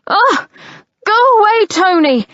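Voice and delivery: high loud exasperated yell. Acted. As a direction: shout him away from the door. Yelling at brother outside door.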